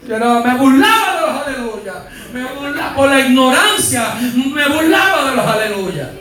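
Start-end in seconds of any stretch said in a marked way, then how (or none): none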